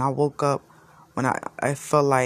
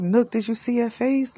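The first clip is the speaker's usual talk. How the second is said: alarmed voice; 10 semitones higher than usual. Retold or imitated.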